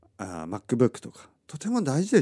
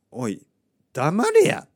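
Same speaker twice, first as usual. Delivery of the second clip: a gruff Kansai-dialect "shut up", high and loud; mock Kansai dialect shut-up, loud; mock Kansai snap; 5 semitones higher, 6 dB louder, much slower than usual. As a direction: bark it in rough Kansai dialect, half joking. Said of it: "関西弁で言いたくなるぐらいの".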